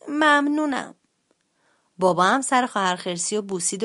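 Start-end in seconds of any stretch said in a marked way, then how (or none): 0.1-0.9 put-on high, strained sick-child voice; wheezy. A narrator's reading. Sick sister bear thanking father, wheezy little voice. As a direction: force it out with a wheeze. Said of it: "خسخس کنان گفت".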